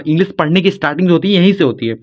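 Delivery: ordinary talk, nothing marked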